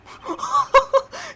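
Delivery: high, loud wheezing cackle; shrieking laugh. Real, not acted. Player cracks up at the reporter, shrieking laugh. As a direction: break into a shrieking fit of laughter.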